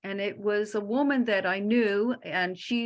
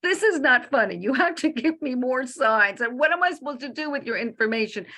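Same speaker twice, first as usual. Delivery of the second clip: higher, louder, rushing scolding; frustrated outburst; 4 semitones higher, 4 dB louder than usual. Retold or imitated. Speaker scolding the casket, frustrated and loud. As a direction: scold the dead friend, exasperated. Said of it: "I was so frustrated".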